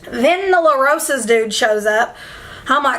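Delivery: normal talking voice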